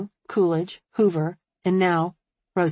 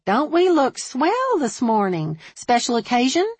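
admiring; 6 semitones higher, 3 dB louder than usual. A narrator's reading. Hairdresser to her dressed-up client, admiring. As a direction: coo with admiration, pitch lifted. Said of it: "Beulah said admiringly".

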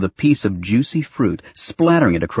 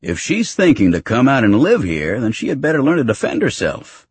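raised, emphatic mock indignation; dramatic protest; 6 semitones higher than usual. A narrator's reading. Husband justifying teasing his son's girlfriend, protesting dramatically. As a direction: argue it theatrically, voice climbing high. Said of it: "he protested dramatically".

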